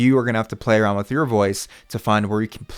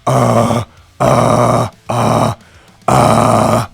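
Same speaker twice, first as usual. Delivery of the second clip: caveman grunts; 9 dB louder, much slower than usual. Shown as a demonstration. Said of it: "pretend like you're a caveman".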